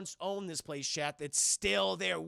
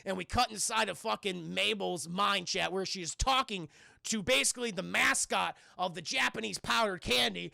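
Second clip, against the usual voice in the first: usual speaking voice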